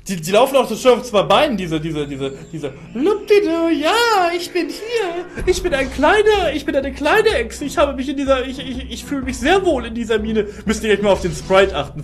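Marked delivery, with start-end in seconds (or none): 2.9-10.5 high, squeaky little creature voice; little lizard voice. Pretend. Streamer voicing a happy lizard enemy to chat, high squeaky creature voice. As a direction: play the cute lizard bopping through the mine.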